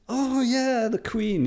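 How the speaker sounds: a mock American suddenly getting it, voice jumping high; delighted American. An American local finally placing England, in a high mock delighted voice.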